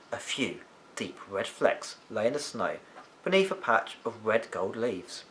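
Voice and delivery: plain reading voice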